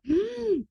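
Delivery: a sudden high sharp intake of breath; sharp gasp. Retold or imitated. Actor opening revised script, sharp gasp. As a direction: gasp in shock at the pages. Shock at the changed script pages.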